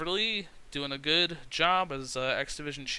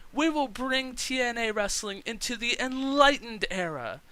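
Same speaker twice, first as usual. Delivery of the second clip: much higher, grandiose mock proclamation; Maria's grand promo; 10 semitones higher than usual. Maria on the mic to the crowd, high and grandiose. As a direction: announce the names like a self-styled goddess. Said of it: "She says".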